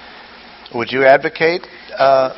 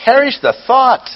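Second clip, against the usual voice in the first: Paul's outcry; 11 semitones higher than usual. Retold or imitated. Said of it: "capable of many translations".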